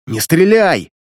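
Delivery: loud urgent shout. A narrator's reading. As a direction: yell to stop him. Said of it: "закричал княжич". (громкий крик)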